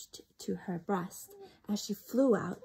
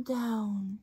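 ordinary delivery

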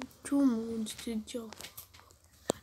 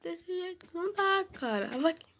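whiny; 6 semitones higher than usual. Real, not acted. Complaining about the glitch.

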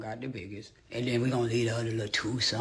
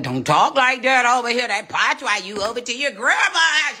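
imitating scolding grandma; 11 semitones higher, 8 dB louder than usual. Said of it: "I be like".

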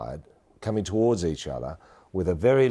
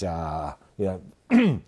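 usual speaking voice

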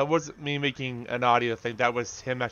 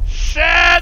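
sudden high, loud yelp of a curse; real startled shout; 10 dB louder than usual. Real, not acted. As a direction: blurt it out in alarm. Sudden danger in the game.

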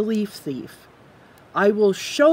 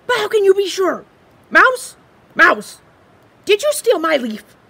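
high, fast, frantic little squirrel voice; excitable squirrel; 11 semitones higher, 9 dB louder than usual. Acted. Squirrel frets over his missing leaf, high and anxious. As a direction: play the squirrel, jumpy and loud.

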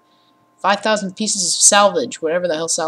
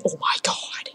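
whispered oh my god; 8 dB quieter than usual. Real, not acted. Reacting to install progress.

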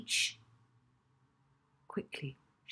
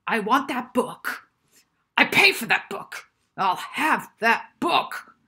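loud, higher, slow and pounding; ranting with fury; 6 semitones higher, 10 dB louder than usual. Acted. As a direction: hammer each demand home. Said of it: "started to rant with fury".

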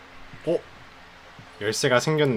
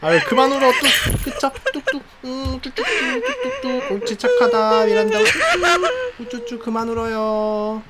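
mock baby soothing; 12 semitones higher, 4 dB louder than usual. Soothing crying ghost like baby.

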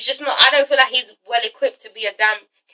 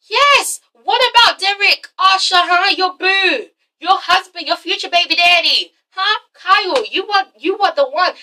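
high, playful needling; teasing excitement; 6 semitones higher than usual. Acted. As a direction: egg her on about her man. Teasing Arsha about Derek.

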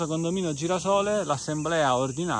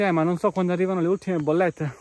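higher, louder mock protest; imagined objection; 5 semitones higher, 6 dB louder than usual. Imagined administrator objecting about the last bills, mock protest. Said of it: "Tu dici".

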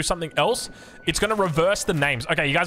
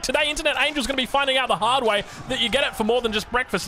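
much higher hyped-up call; excited; 7 semitones higher than usual. Real, not acted. Caster hyping the English sandwich to viewers, high excited call.

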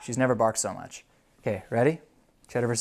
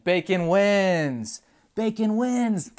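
excited winner call; 6 semitones higher, much slower than usual. Real, not acted. Announcing the winner to the puppy.

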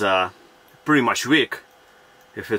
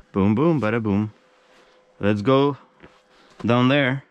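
ordinary talk

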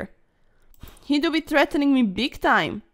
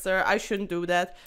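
ordinary talk, nothing marked

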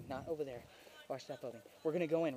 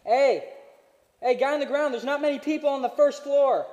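loud, high callouts; shouting callouts; 6 semitones higher, 8 dB louder than usual. Real, not acted. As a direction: yell positions to the team. Calling out enemy positions.

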